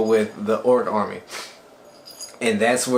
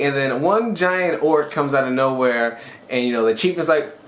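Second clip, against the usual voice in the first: ordinary delivery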